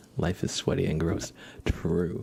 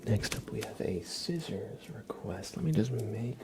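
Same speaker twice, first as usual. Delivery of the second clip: ASMR whisper; 3 semitones higher, 5 dB quieter than usual. Acted.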